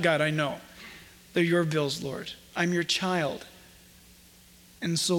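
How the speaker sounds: low, gently spoken prayer; acted prayer. Preacher models a morning prayer to the Lord, low and trusting.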